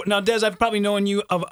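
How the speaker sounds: ordinary talk, nothing marked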